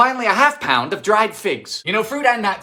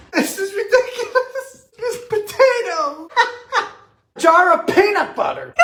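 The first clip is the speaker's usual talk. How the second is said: high squeaky laughing speech; helpless laughter; 11 semitones higher than usual. Real, not acted. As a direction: talk through uncontrollable giggles. Cracking up at the recipe.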